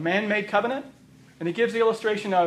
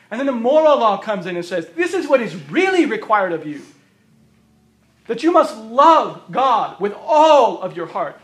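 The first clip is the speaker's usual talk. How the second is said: preacher booming out the law's demands; preaching at full force; 3 semitones higher, 8 dB louder than usual. Acted. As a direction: hammer each "all of your" like a verdict. Preacher reciting the moral law.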